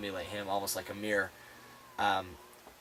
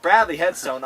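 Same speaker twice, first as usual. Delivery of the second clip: ordinary talk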